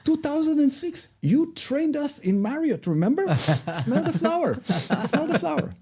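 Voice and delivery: high, loud eager man voice; excited former trainee. Retold or imitated. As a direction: act out the thrilled trainee.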